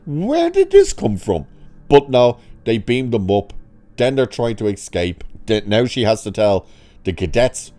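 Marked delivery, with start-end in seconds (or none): 0.1-1.4 high-pitched disbelieving squeak; baffled outburst. Retold or imitated. Reviewer squeaking at a baffling plot twist, incredulous. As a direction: pitch way up as if the twist came out of nowhere.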